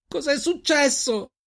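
very high, drawn-out cry; anguished scream. A narrator's reading. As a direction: scream the question in desperation, stretching it out. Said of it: "urlò lei".

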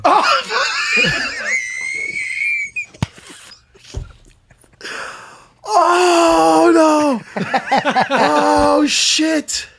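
high howling laugh at the stench; shrieking laughter. Real, not acted. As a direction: squeal with laughter, then groan. Reacting to the smell.

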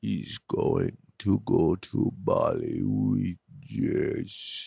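low, slow, soft creak of a walking coffin; Rob. Retold or imitated. Co-host imitating Rob about Bali, walking coffin voice. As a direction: drag it out like a talking coffin. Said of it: "He sounds like he's a walking coffin".

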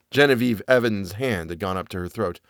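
usual speaking voice

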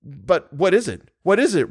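urgent, high-pitched pleading question; imploring. A narrator's reading. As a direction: implore her to explain, pitch climbing. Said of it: "Raoul implored".

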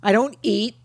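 normal talking voice

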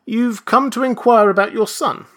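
a hint of a courteous official; polite registrar. A narrator's reading. Registrar greets Benjamin, politely assuming a father. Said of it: "said the registrar politely".

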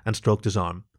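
ordinary delivery

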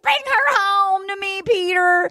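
a lightly voiced mother begging her son; pleading mom. Mother begging Peter to bring her home, high and slow.

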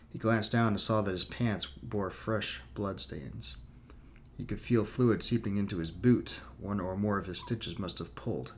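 plain reading voice

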